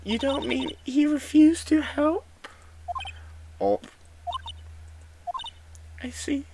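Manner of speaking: a small high voice, let down; dejected young girl. Acted.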